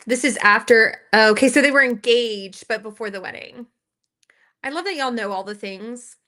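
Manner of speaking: ordinary talk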